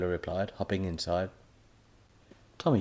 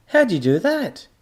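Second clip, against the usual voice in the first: amazed; 13 semitones higher, 11 dB louder than usual. A narrator's reading. Tommy amazed.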